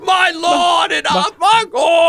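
put-on high, slow old coot yelling; old prospector. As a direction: shake a cane at the kids. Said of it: "he shakes it at all the kids".

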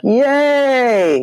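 high, drawn-out mock "yay"; hammed-up little hooray; mock cheer. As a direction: cheer with exaggerated glee. Acted sarcastic cheer at the meeting.

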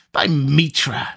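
sudden sharp oath; electric exclamation. A narrator's reading. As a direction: blurt it out like a jolt. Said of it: "the electric quality of the exclamation".

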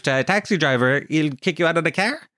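ordinary delivery

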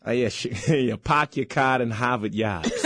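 broad Boston, dropping the Rs; amused Boston impression; park-the-car Boston accent. Shown as a demonstration. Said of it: "How about Boston?".

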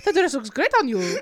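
giggly put-on French accent; playful attempt at a French accent. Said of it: "Should I try?".

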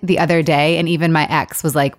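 normal talking voice

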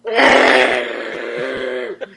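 long mock straining noise; red-in-the-face effort sound, hammed up; imitated straining. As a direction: strain as if about to burst. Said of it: "And he's going".

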